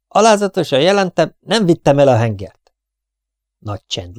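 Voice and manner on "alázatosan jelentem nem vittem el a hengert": dutiful soldier. Acted. Soldier reporting to captain.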